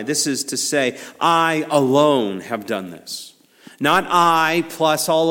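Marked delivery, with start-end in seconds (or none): 1.2-3.3 "I alone" slow, with weight on I; emphatic I. Acted. Preacher quoting God as sole redeemer, emphatic on I alone. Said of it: "this is an emphatic "I".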